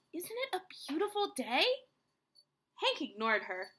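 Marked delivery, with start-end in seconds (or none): 0.1-1.6 higher-pitched, chirpy question; cheerful. A narrator's reading. Rosie the tumbleweed greets grumpy Hank, cheerfully. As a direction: chat brightly to a neighbour. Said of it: "said Rosie cheerfully".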